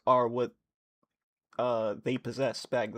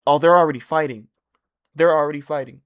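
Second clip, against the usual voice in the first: much louder, quick and thrilled; excited; 11 dB louder, faster than usual. Real, not acted. Reader hyped the fight began, loud and fast. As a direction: blurt it out, hyped.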